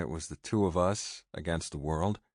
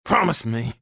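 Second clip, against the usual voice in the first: loud, higher, forceful demand; demanding shout; 5 semitones higher, 7 dB louder than usual. A narrator's reading. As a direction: bark it while shaking him. Shaking him, demanding.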